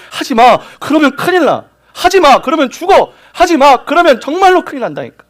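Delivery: high, loud repeated pleas to stop; urgent pleading warning. Acted. God warning his children, high urgent pleading.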